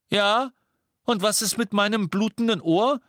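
high, loud cheeky protest; injured twin protesting; indignant twin. Acted. George cuts in indignantly.

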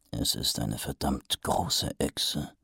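low, slow, hushed doubt; nagging inner voice. A narrator's reading. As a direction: murmur it low, slowly. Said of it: "gaben sie zu bedenken".

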